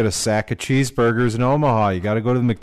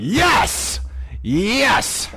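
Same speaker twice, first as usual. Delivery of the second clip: much higher, shouted yes; triumphant shout; 10 semitones higher than usual. Real, not acted. Crowing at the co-host after proving him wrong: excited shout. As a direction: gloat at winning the bet.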